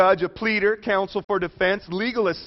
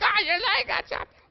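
much higher, squealed mock panic; mock scream like a baby; 14 semitones higher than usual. Preacher mocking himself screaming like a baby at a friend's injury, high squeal. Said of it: "screaming like a baby".

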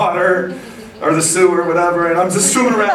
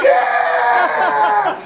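high, shouted, laughing Yeah; exultant yell. Retold or imitated. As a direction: let it ring across the beach. Celebrating in the water.

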